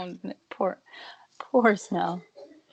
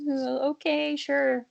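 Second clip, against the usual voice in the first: higher-pitched, doubtful humouring; sarcastic agreement; 6 semitones higher, slower than usual. Acted. Podcaster humours Machado's claim of not remembering, sarcastic agreement. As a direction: play along as if you don't buy it. Mocking a player's unlikely denial.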